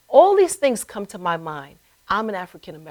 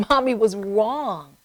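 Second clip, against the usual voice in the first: ordinary talk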